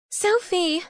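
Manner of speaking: high, delighted call; excited cry. A narrator's reading. Laurel spots her freed friend bursting in, excited cry. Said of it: "Laurel cried".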